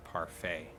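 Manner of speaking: "parfait" said the French way, pahr-FAY; "parfait" with a French accent; French word. Shown as a demonstration. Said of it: "as the French would say".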